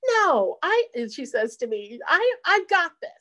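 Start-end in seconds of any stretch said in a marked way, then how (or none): 0.1-0.9 imitating mom, higher and louder, brushing off help; a stubborn mom waving her daughter off; insistent refusal. 2.1-3.1 imitated mom, much higher and louder, insisting she can manage; stubborn insistence. Mom refusing help in story.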